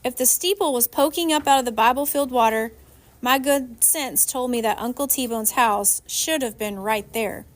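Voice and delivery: ordinary delivery, nothing marked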